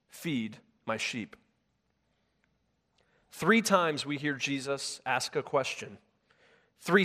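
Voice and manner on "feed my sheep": soft command. Acted. Jesus' quiet reply.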